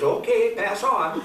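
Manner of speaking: sheriff waving through. Acted. Sheriff letting the car through the roadblock, mock official call. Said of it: "Sheriff says".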